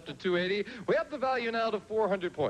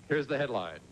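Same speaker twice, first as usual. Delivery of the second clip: ordinary talk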